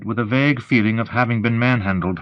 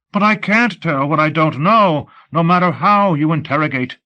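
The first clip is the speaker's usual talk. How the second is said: higher-pitched panicky protest; panicked; 6 semitones higher than usual. A narrator's reading. Prisoner told he will be interrogated, panicking. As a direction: plead in rising panic, voice raised in pitch. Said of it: "I was in utter panic. I said, stuttering".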